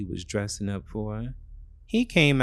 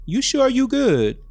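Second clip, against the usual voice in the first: put-on boyfriend voice; 10 dB louder than usual.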